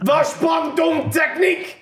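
hammed-up German yell, slow and high; mock German shouting. Mock German shouting at a woman heading for the loo. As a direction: bellow it like a panicking German man.

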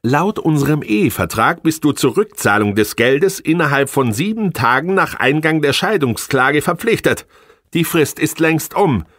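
high, contemptuous scolding woman; scornful wife. Acted. Angry wife berating her failing husband, high and scornful. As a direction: lash him with every reproach. Wife voiced citing contract.

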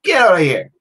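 imitated brush-off with falling pitch; punchy, retold dismissal sliding downward; dismissive retort. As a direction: wave the worrier away firmly. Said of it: "She said".

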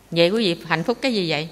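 usual speaking voice

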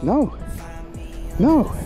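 usual speaking voice

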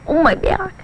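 high mock groan; giraffe's complaint. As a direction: pipe up as the aching giraffe. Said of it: "then they sounded like this".

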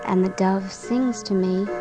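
ordinary delivery, nothing marked

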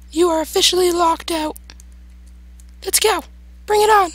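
very high falsetto taunting; mock-defiant falsetto squeak; squeaky put-on voice. Player taunting the monster locked outside, high squeaky put-on voice.

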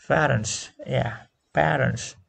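parents with an open a as in cat; teacher stretches the a in parents; pronouncing parents. Shown as a demonstration. Teacher models parents for the class, open vowel. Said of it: "is pronounced as fa".

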